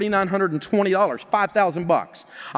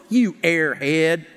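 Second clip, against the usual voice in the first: ordinary talk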